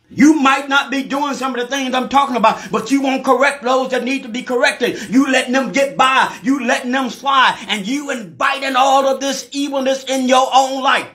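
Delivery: high, driving sermon voice; full-force preaching. Real, not acted. Preacher rebuking those who let others slide, high full-force preaching. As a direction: preach it high, in relentless phrases.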